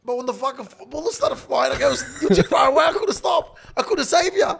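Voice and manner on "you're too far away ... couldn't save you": high, loud, laughing cry; hammed-up plea. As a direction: overplay the excuse, laughing. Retold plea to girlfriend.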